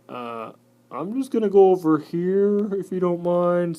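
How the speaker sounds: high, loud, polite little spider; hammed-up timid spider; timid cellar spider. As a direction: ask to slip past, loudly meek.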